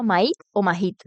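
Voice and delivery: first "Mahit" with silent H, second "Mahit" with aspirated H; slowly compare "Mahit" without and with a breathed H; alternative pronunciations. Shown as a demonstration. Streamer asks how to say the name, "Mahit" both ways. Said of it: "¿Cómo le digo, tío?".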